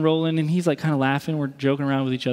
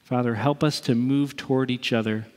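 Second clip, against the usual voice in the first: closing prayer; 2 semitones lower, slower than usual. Acted.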